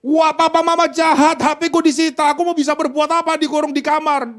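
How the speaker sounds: put-on high, whining kid protesting his parents; sulky teen whining about a confiscated phone; whiny child complaint. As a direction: pout like a sulky teen whose phone was taken.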